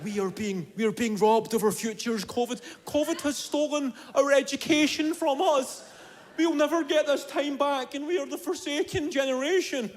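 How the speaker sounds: high, stressed-out whinge; mock a hard-done-by student, pitched well up; complaining dweebs.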